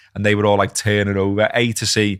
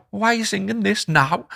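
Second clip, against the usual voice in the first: mock indignation; 11 semitones higher than usual. Baffled fan questioning rivals' chant.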